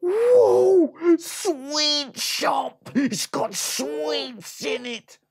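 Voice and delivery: very high, loud, slow squeal; excited piglet. Acted. Piglet amazed by the sweet shop, high squeal. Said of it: "and he said".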